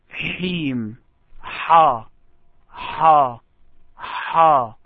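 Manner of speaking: حيم and حا with a strong breathy h; careful separate syllables, حيم then حا; pronunciation drill. Shown as a demonstration. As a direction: isolate the h of حا, falling pitch. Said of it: "just pronounce this word".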